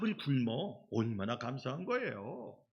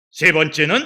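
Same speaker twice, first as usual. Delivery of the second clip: ordinary talk, nothing marked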